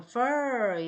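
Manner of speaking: fur drawn very long with the jaw down; teacher stretching the vowel of fur; long dropped-jaw fur. Shown as a demonstration. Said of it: "턱을 좀 내려주신다".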